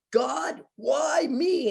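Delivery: high, loud plea to heaven; exasperated cry. Retold or imitated. Mentor cries to God driving home unpaid, exasperated. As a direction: wail it upward in comic despair.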